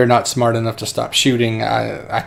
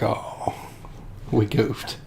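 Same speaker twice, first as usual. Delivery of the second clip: soft, deflated murmur; mock sheepish realisation; 12 dB quieter, much slower than usual. Both men seeing their mistake, hushed sheepish. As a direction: let it sink out quietly.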